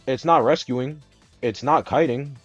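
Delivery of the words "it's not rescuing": ordinary talk, nothing marked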